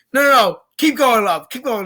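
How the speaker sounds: a hint of a pirate cheering her on; mock eager urging. Pirate urging woman into sea.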